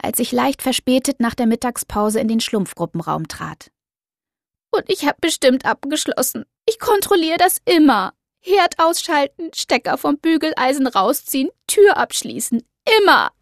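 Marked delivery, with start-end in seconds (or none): 4.7-13.3 high, distraught and insistent; frantic, near tears; upset young woman. Acted. Doro upset, voiced by narrator.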